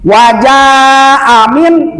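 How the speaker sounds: wajah amin with a long held "ja"; long vowel. Shown as a demonstration. Teacher shows how it is read, Wajah held long. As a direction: stretch the jah of Wajah before amin. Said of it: "Jadi panjang sebab ada bendera".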